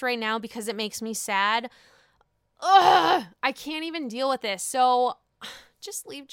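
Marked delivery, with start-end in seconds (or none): 2.6-3.2 loud exasperated ugh; annoyed ugh. Real, not acted. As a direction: groan it out, fed up. Groan at sad situation.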